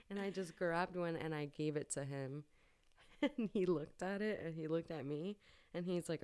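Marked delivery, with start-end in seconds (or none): none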